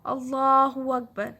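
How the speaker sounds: اللہ اکبر drawn out slowly and loudly, with the doubled l held; slow recited takbir. Shown as a demonstration. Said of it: "پھر اس کے بعد تکبیر ہے".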